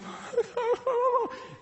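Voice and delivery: high-pitched mock whimper; imitated crying noise. Crying son looking at dad from the mound, mock whimper. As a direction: wail wordlessly like a sobbing little boy. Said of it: "he's kinda just going".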